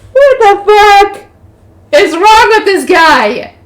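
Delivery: high, slow, loud shout; murderous outrage. A narrator's reading. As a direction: roar each word as if ready to kill. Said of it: "gangster sounded ready to kill him".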